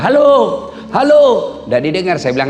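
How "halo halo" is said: high, loud "hello" holler; comic yelling. Acted. Old man with receiver upside down, high mock shouting. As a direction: shout it down a dead phone line. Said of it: "Teriaklah dia".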